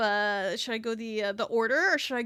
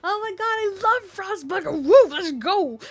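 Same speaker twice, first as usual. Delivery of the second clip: high, loud burst of delight; thrilled squealing; 10 semitones higher, 11 dB louder than usual. Real, not acted. Streamer learns they're playing Frostpunk, thrilled squeal. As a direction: squeal it like a fan who can't contain it. Thrilled at getting favourite game.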